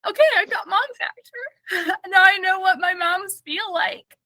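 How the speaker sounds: high, tearful laughing through the words; laughing to tears about her son's rejection; crying and laughing. Real, not acted. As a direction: laugh so hard it nearly turns to tears. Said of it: "crying and laughing".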